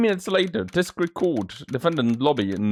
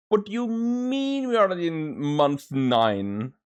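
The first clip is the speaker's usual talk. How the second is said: ordinary talk, nothing marked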